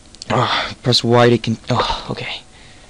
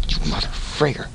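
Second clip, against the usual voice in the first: hushed curse; 7 dB quieter than usual. Real, not acted. Hushed curse when trapped.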